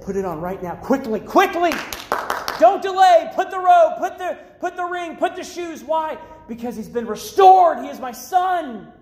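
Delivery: high, punchy, urgent proclamation; impassioned preaching. Acted. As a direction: rattle off the commands like a fired-up preacher. Preaching at full force.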